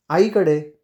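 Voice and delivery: short, falling, matter-of-fact reply; curt answer. A narrator's reading. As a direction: answer curtly at normal volume. Curt answer, then echoed back.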